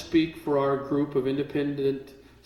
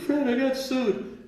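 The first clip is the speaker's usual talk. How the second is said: panicked friend; 9 semitones higher, much slower than usual. Retold or imitated.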